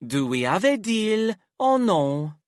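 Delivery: high lilting sing-song. Acted. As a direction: toss it off lightly in sing-song. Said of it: "in a sing-song voice".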